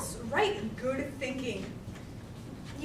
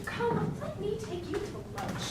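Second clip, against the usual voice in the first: soft, slow, high-pitched cajoling; soothing, lilting offer; coaxing invitation; 8 semitones higher, 6 dB quieter, slower than usual. Acted. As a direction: sweet-talk him gently. Coaxing the calmed accountant.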